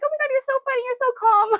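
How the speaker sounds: very high, delighted squeal; gushing nurse. Retold or imitated. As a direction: play the nurse charmed and amused. Nurse gushing, voiced in retelling.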